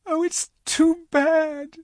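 high, slow tearful wail; sobbing. Acted. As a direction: sob the words out in grief. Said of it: "sobbed Lucy".

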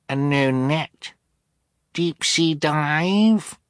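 put-on very high, slow toddler voice; small child's voice. A narrator's reading. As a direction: draw it out like a little girl. Youngest child's voice in story.

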